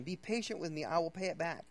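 ordinary talk

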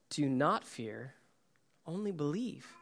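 slow, soft and steady; calm reassurance. Acted. Jesus calming Jairus after the bad news, gentle and slow. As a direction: soothe a terrified father.

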